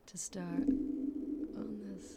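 slow breathy whisper, very close to the mic; ASMR whisper. Shown as a demonstration. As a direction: whisper it slowly like an ASMR video. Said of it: "I was trying to do a little A S M R".

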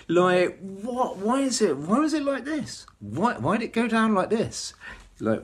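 exasperated outburst. Retold or imitated. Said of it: "you just say".

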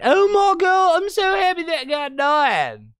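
high-pitched, hammed-up gleeful cheering; exaggerated fake jubilation, loud at the start; mock celebrating. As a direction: squeal like an overjoyed fan, sarcastically. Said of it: "when people are celebrating".

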